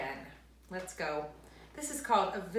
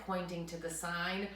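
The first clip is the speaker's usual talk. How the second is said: ordinary delivery, nothing marked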